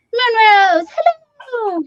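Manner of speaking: very high, loud sing-song hello; excited hello. Real, not acted. Greeting a viewer in chat with a very high excited hello. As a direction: call out a bright welcome.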